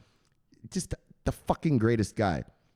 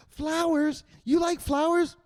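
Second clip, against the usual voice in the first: put-on much higher, delighted girl voice; girlish surprised voice; slower than usual. Teresa delighted he likes flowers, much higher girlish voice. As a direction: play the charmed girl. Teresa answers in acted dialogue.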